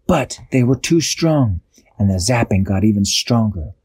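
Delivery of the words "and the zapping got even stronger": plain reading voice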